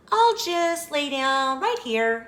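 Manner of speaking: put-on high, girlish character voice; Goldilocks's voice. A narrator's reading. Goldilocks picks a bed to rest on, high girl's voice.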